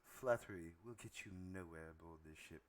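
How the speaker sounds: low, soft, quick seductress voice; seductive first mate. Acted. First mate answering a flatterer, seductive. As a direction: keep it low and hushed, a sultry rebuff.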